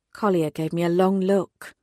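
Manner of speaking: ordinary delivery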